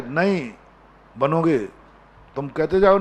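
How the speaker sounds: usual speaking voice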